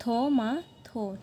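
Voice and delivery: "थो मा थो" slowly, syllable by syllable; Korean pronunciation. Shown as a demonstration. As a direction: break "थो मा थो" into three slow parts, aspirated th. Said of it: "Korean में टोमेटो को कहते हैं". ("थो मा थो" धीरे-धीरे, कोरियन उच्चारण में)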